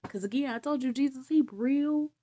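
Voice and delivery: usual speaking voice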